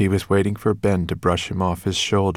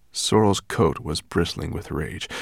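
ordinary delivery

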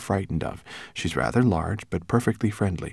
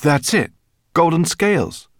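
loud, high exclamations; excited cry; 11 semitones higher, 9 dB louder than usual. A narrator's reading. Ben remembers his golden scale, excited cry. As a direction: call it out as the answer hits you. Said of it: "he cried".

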